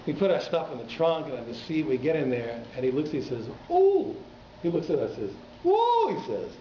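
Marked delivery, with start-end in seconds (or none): 3.7-4.2 high, loud impressed ooh; a squeal of delighted surprise; taxi driver's ooh. Retold or imitated. Taxi driver marvelling at the boys: high ooh. 5.7-6.2 high, loud impressed ooh; a squeal of delighted surprise; taxi driver's ooh. Retold or imitated. Taxi driver marvelling at the boys: high ooh.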